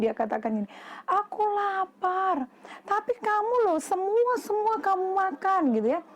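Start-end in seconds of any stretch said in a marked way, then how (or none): 1.1-2.5 high, slow storybook caterpillar; drawn-out little plea of hunger; hungry caterpillar. Acted. Caterpillar answers the bee. 2.8-5.6 lightly acted high-pitched objection; mild storybook scolding, pitched up; protesting bee.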